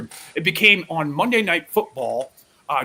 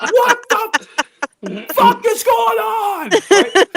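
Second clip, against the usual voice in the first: very high, loud and slow panicked yell; mock shocked shout; 9 dB louder, much slower than usual. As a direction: scream it like startled partygoers.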